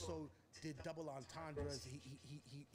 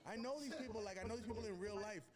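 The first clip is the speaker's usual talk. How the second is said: higher, excited bragging; proud boast; 6 semitones higher than usual. Retold or imitated. Rapper tells the doubting crowd he knows them, higher excited boast. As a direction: brag it up, pitched high. Retelling his proud reply.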